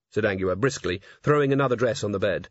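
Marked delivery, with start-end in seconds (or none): none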